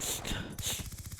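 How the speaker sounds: imitated tearful sniffles; soft mock sniffles; sniffing. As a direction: sniff like a kid who has been crying. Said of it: "still sitting there sniffing".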